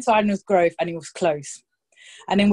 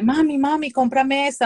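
put-on higher, pleading little-boy voice; begging child; 6 semitones higher, 3 dB louder than usual. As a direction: whine it like a kid who wants a toy. Son begging mum in shop.

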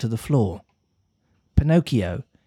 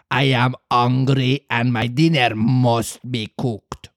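slow gruff puppet master refusing mercy; stern refusal; slower than usual. Acted. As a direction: deny mercy coldly. Fire Eater refuses mercy.

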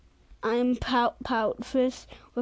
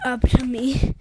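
loud finish; 8 dB louder than usual. Acted. Ends the fish's line.

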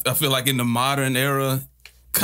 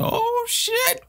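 surprised. Retold or imitated. Excited retold reaction.

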